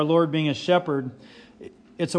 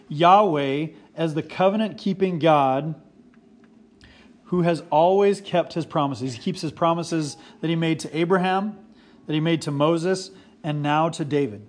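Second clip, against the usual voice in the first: normal talking voice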